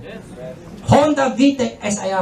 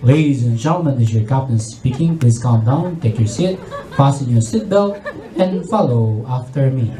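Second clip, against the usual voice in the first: much lower, slow calm pilot voice with a laugh; composed captain; 10 semitones lower than usual. Retold or imitated. Captain addressing passengers amid chaos, low slow composed voice. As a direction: stay calm while the plane fails. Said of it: "And still composed".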